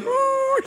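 a high, pleased "ooh" sliding up; delighted high ooh. Retold or imitated. Woman being prayed for, delighted high coo. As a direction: coo with sudden joy, way up high. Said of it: "And she's like".

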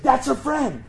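high-pitched excited retelling; sudden high-pitched realization, re-enacted; excited recognition. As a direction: blurt it out high, like you just spotted her. Retelling spotting the friend from truck.

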